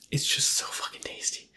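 breathy savoring whisper, barely voiced. Real, not acted. Host relishing dessert to viewers, whispered. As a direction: whisper it like a guilty pleasure.

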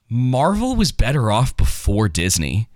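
each word of "Marvel was better off before Disney" given weight, slow; slow emphatic verdict. Real, not acted. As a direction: lean on it slowly as a firm verdict.

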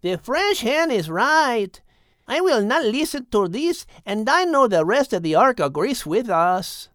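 firm wife backing the hen; Maria the raven wife. A narrator's reading. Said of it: "chimed in Rico's wife, Maria".

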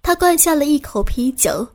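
ordinary delivery

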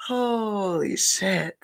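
slow, drawn-out stunned gasp of words; stunned holy shit. Retold or imitated. Reacting to MJ's video, slow stunned disbelief. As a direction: let it hang in disbelief.

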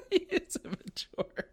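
high, slow words squeezed through a laugh. Real, not acted. Podcaster joking to co-host about an immature character, laughing. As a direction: giggle out the verdict.

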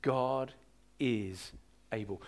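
"God", "is", "able" each set apart by pauses; slow, hushed word-by-word emphasis. Shown as a demonstration. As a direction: drop the voice and space out every word. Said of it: "I just want that to go deep down".

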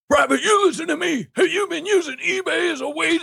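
high barking drill instructor; drill sergeant. Acted. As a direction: bark orders at a recruit. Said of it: "I'll be the drill sergeant".

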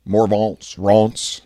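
Morvant's Rants said slowly in French style, the T silent, the a open. Shown as a demonstration. Testing his segment title in French, drawn out slowly. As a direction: try Morvant's Rants the French way and let it fall flat. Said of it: "No, that doesn't work".

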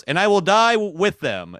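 high, loud, grand pronouncement; solemn Aragorn line. Retold or imitated. Retelling the movie, he booms Aragorn's vow. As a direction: ham up a noble hero's vow. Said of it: "Aragorn in English just goes".

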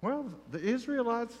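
normal talking voice

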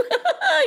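high, light burst of giggles; playful laugh. Acted. Krishna enjoys bouncing the serpent, high laughing. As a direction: giggle in delight. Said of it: "He was laughing".

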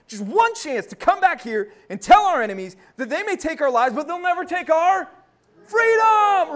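rousing battle speech. Retold or imitated. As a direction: rise to a roaring cry of freedom. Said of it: "otherwise I'd try to give it to you".

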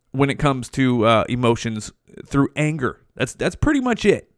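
ordinary talk, nothing marked

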